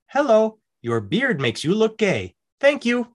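high, chirpy-polite clerk delivering a note; hotel clerk. Retold or imitated. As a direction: sing out the politeness, unaware of the insult. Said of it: "she looks at him and goes".

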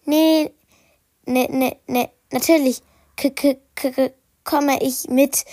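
nervous stammer, tripping over the k; flustered budgie stammering; stuttering. Acted. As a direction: stammer it out, caught and embarrassed. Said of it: "Da stotterte Wendy".

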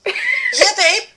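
imitating a friend's Manchester accent, starting high; Mancunian accent. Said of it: "Man-, Mancunian accent".

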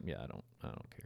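very low, barely voiced mumble; retold indifference. Reader recalling his reaction to a recommended book, indifferent. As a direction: let it trail away under your breath, uncaring.

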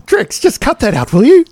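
mock scolding mother; a light impression of a scolding mother; imitating his mother. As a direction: nag him like a disapproving mum. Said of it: "She's going".

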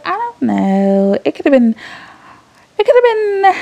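mocking her ex. Telling viewers what her ex said, in a lightly mocking voice. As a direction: mimic the ex whining, stretching the words. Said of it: "she doesn't talk like this".

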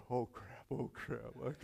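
soft, low worried muttering to himself; hushed panic. Retold or imitated. Kid hiding in bed after the break-in, hushed panic. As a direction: fret under your breath, hiding in bed.

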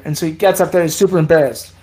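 ordinary talk, nothing marked

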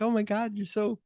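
put-on high, breathy-soft swooning girl. Retelling a girl swooning over a poem, high and soft. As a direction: gush like a smitten girl, high and hushed. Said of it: "the other girl's like".

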